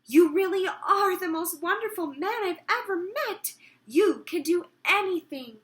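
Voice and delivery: high, loud, delighted praise; crying out joyfully. Acted. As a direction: gush at him with full delight. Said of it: "cried out".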